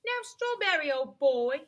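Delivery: lightly voiced cabby, calling out; hearty cabby. A narrator's reading. Cabby hailing his old horse Strawberry, hearty.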